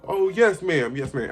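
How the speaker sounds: loud, high, over-eager yes; eager helpfulness. Retold or imitated. Rescued by lady needing help, loudly eager. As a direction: jump at the request, relieved. Seizing a chance to escape.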